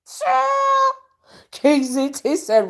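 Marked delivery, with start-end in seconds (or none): 0.1-0.9 high, drawn-out squeal of disbelief; mocking exclamation. Real, not acted. Vlogger scoffing at a rival's boast, mocking high squeal. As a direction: let it soar way up and hang.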